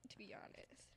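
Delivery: soft unvoiced whisper. Real, not acted. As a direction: confess it under your breath. Quiet admission during chat.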